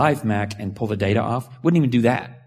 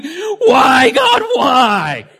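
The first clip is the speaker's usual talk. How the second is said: hammed-up shout, much higher and louder; mock scream at God; 10 dB louder, much slower than usual. Preacher imitating his imagined cry to God, mock shout. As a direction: wail it like a melodrama.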